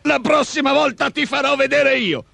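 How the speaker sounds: high, enraged ogre warning; threatening, foaming rage. A narrator's reading. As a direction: vow revenge in a high creature voice. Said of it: "minacciò l'omone schiumante di rabbia". (orco minaccioso, voce acuta)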